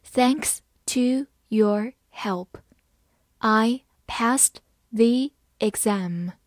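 slow, each word spaced out; deliberate slow classroom reading; slow read-along. Shown as a demonstration. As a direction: read it slowly so students can repeat. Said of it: "慢慢来读".

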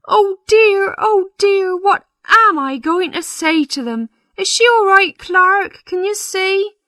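very high, fretful parson voice; flustered parson. Acted. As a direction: panic and appeal to the clerk. Parson frets at the tower.

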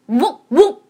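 barking it out eagerly; excited dog bark. Acted. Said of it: "它激动地叫着".

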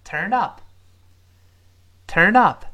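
"turn up" run together as "turn-dup", slow and loud; linked turned up. Shown as a demonstration. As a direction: model "turn up" with a light "dup" at the end. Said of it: "这个连读的声音".

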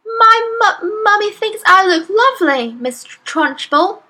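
put-on high, stammering little-girl voice; frightened stutter. A narrator's reading. Amanda answering back to Miss Trunchbull, stuttering in fright. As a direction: stutter it, shaking like a blancmange. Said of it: "Amanda stuttered".

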